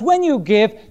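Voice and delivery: normal talking voice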